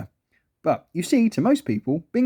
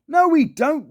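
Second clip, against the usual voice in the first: sternly; 11 semitones higher, 4 dB louder, slower than usual. A narrator's reading. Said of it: "replied Joyce sternly".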